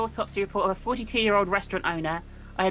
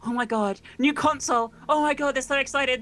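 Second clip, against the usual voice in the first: mock excitement; 6 semitones higher than usual. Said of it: "everyone was like".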